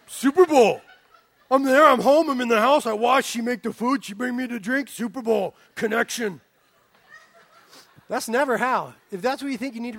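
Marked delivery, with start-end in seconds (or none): 0.1-0.8 loud hammed-up cheer; mock excited husband. Preacher playing a sports-mad husband, loud cheer. 1.5-6.4 loud, laughing, broken-English husband; mock husband. Preacher mocking a husband at the Super Bowl, loud and laughing. Husband describes ideal evening.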